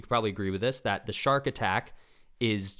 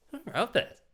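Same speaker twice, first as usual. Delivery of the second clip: amused praise. Real, not acted.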